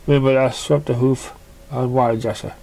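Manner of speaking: ordinary delivery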